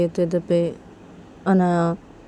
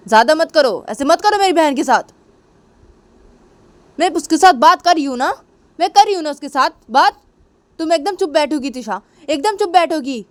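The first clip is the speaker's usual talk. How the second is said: much higher, indignant, insistent scolding; angry scolding; 8 semitones higher than usual. Retold or imitated. Speaker scolding Tisha for hurting her sister, indignant. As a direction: shut her up, protective of the sister. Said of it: "तो मैंने बोलती".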